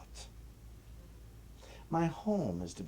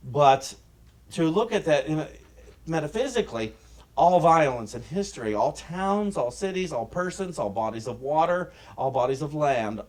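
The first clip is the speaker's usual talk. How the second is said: loud, high, driving pulpit delivery; preaching; 4 semitones higher, 7 dB louder than usual. Acted. Minister preaching to the congregation, loud and high. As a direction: preach it out to the congregation with rising force.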